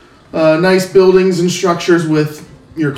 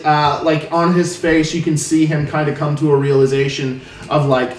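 usual speaking voice

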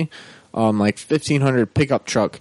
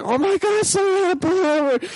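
very high, shrill imitated shriek of terror; mom shrieking in panic. Mom gripping her son's chest as a truck spins out, very high panicked shriek. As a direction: screech it like a terrified mother. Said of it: "It was like".

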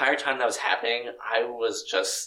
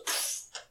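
soft, voiceless pfft; quick puff of air for an abrupt ending; imitated cut-off noise; 11 dB quieter than usual. Retelling how the film just ends, soft pfft.

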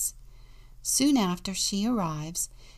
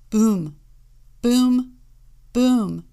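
three loud, separate booms; loud, punched booms with a beat between; booming knocks; 4 semitones higher, 11 dB louder, much slower than usual. A narrator's reading. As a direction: boom it out like heavy knocks on a door. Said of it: "there was a knock at the door. It went".

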